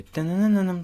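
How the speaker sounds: high sung "tananananam"; imitated instrument. Reviewer recalls a sound from the beat, imitated riff. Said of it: "je sais pas quel instrument c'est".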